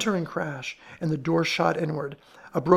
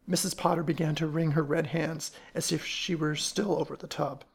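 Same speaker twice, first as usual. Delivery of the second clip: ordinary delivery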